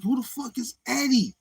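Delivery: high incredulous question; baffled outburst. Retold or imitated. Retelling his confusion over the song, a high bewildered outburst. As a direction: ask it totally bewildered.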